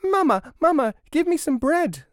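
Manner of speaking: put-on very high, loud childish scream. A narrator's reading. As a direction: scream for mother in a tiny high voice. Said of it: "screamed another".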